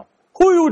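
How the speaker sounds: 突然高声哎呦; 被烫得一声尖叫; 被烫惨叫. Acted. 被热茶壶烫到. (被烫得哎呦一声)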